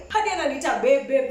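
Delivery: normal talking voice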